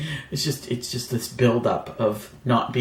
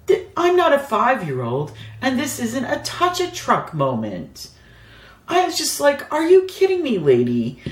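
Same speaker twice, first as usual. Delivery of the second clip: much higher, rising indignant rant; indignant mock outrage; 9 semitones higher than usual. About being asked to touch the machine, high indignant mock outrage. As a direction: tell her off in your head.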